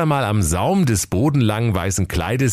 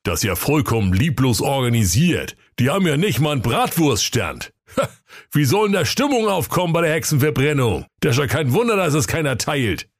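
raised, mildly indignant brother; a touch of outrage at the sloppy event; [name] calling out; 8 semitones higher than usual. Acted. [name] zooms in and complains, a hint of indignation.

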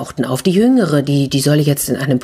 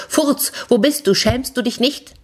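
high-pitched scolding call; acted indignation, pitched high; indignant calling out; 8 semitones higher than usual. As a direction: call out and tell someone off. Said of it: "Sie rief dennoch".

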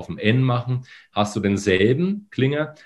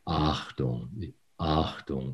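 soft gentle onset; 4 semitones lower, 9 dB quieter, much slower than usual. Shown as a demonstration. Voice coach showing a soft onset, lower and quieter. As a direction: lower and softer, easing in lightly. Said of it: "ganz leicht und weich reingehen".

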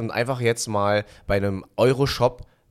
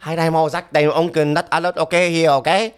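higher, louder bossy father; angry dad; 7 semitones higher, 5 dB louder than usual. Retold or imitated. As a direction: bark orders like a strict dad. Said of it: "mein Vater".

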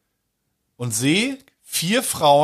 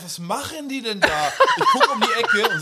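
laughing while telling; 12 semitones higher than usual. Real, not acted. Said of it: "laughing retelling".

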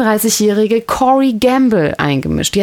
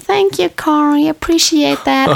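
mock polite thanks; 8 semitones higher, slower than usual. [name] thanking Corey dismissively.

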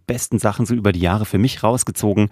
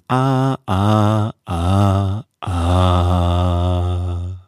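stepping down; 2 semitones lower, much slower than usual. Shown as a demonstration. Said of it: "Ich mach dir das heute mal vor".

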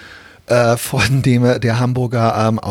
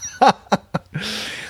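high burst of laughter; 9 semitones higher, 4 dB quieter than usual. Real, not acted. Laughs at the drunk memory.

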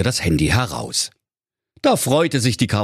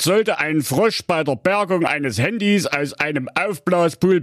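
officious put-on voice, pitched up; father reading rules; 9 semitones higher than usual. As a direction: read out the small print, punctuation included. Father reading small print aloud.

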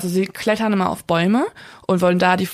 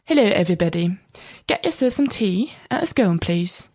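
hammed-up British English accent. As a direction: lay the British accent on thick. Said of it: "mit so einem richtig fetten britischen Akzent".

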